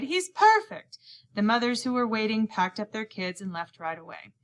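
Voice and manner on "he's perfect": high, loud delighted exclaim; excited cry. A narrator's reading. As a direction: gush over the boy. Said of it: "he cried".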